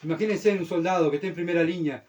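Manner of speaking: ordinary talk, nothing marked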